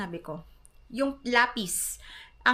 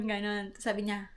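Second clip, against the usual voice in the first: normal talking voice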